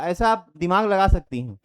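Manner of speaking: ordinary delivery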